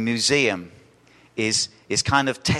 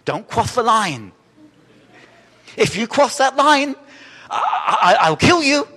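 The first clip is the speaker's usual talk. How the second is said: lisping pharaoh, rising higher and louder into a threat; 11 semitones higher, 7 dB louder than usual. Retold or imitated. Preacher imitates movie pharaoh threatening the museum guard, lisping. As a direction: build from a lisped warning to a shrieked threat. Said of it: "He kind of speaks with a lisp".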